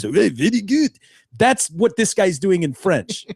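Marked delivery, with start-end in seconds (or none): 0.0-1.0 a light mock Japanese caricature; a hint of a cartoon Japanese voice; caricature accent. Mocking a cartoon cast's accents, light mock Japanese. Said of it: "a Mexican accent or something".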